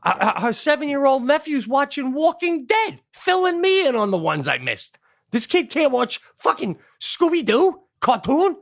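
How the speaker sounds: high-pitched indignant complaint; incredulous ranting. Real, not acted. Indignant rant about his scared kid.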